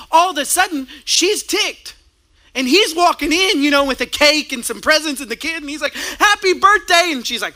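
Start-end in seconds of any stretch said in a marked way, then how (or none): 6.2-7.1 higher, louder bright happy birthday; cheerful greeting. Retold or imitated. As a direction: burst in proud and upbeat. Husband greets wife walking in.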